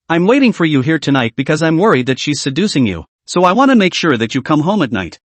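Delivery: ordinary delivery, nothing marked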